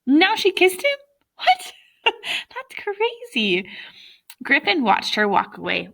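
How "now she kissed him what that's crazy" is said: high, slow amused disbelief; laughing disbelief. Real, not acted. Reader's amused aside.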